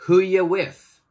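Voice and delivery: "who you with?" with "you" cut to a quick "yə"; casual joined demo; reduced "you". Shown as a demonstration. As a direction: blend "who you" into one. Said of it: "Changes to".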